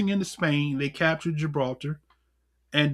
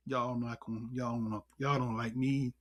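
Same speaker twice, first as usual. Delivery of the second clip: soft, lower, half-sighed aside; sighing aside; 6 semitones lower, 11 dB quieter than usual. Real, not acted. Teacher sighing softly that the listeners don't like him. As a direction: mumble it to yourself after a sigh.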